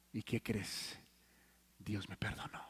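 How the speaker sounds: hushed whisper of relief. Real, not acted. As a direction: breathe it out as a secret grace. Hushed climax of testimony. (susurro de alivio)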